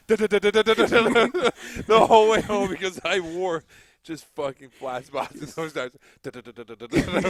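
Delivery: rhythmic da da da of flat-spotted tires; imitating flat tires thumping; tire thump imitation. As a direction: mimic the bumping tires. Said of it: "flat tires thumping home".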